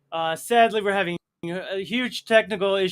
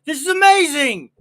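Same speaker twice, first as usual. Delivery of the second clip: excited gush; 9 semitones higher, 8 dB louder than usual. Retold or imitated. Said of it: "I go".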